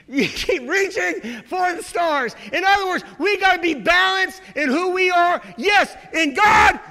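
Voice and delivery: loud, slow preacher's shout; full-force preaching. Real, not acted. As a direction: hammer each line at the congregation.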